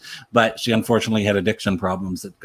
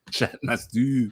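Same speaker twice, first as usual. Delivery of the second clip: softer, slow Scottish echo; Scottish accent; 6 dB quieter, slower than usual. Shown as a demonstration. Actor echoing his own line after laughing, subdued Scottish accent. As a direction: say it in Scottish, slower and held back. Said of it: "in my best Scottish accent".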